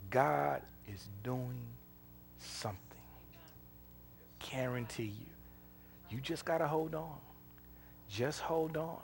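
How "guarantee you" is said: a hushed, intimate assurance; whispered promise. Real, not acted. Preacher whispering assurance to the congregation, hushed. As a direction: breathe the words out with no voice.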